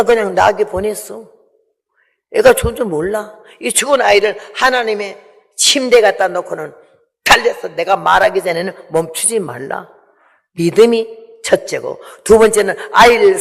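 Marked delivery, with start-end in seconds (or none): none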